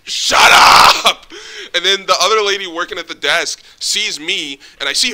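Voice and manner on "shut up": loud yell of frustration; fed-up yell. Retold or imitated. Losing patience with the old lady, loud fed-up yell. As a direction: bark it out, fed up. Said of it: "I'm like".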